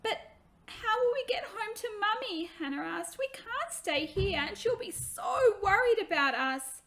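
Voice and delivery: put-on a child close to tears missing her mother; worried little girl. Hannah worries about Mummy.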